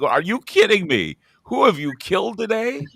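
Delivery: high incredulous echo; mocking the PSA slogan in disbelief. Incredulous retort.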